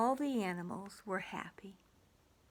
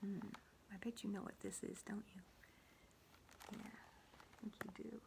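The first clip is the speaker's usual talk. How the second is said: soft secret whisper; whispered aside; 16 dB quieter, faster than usual. Real, not acted. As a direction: lean in and whisper. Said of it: "whispered aside to child".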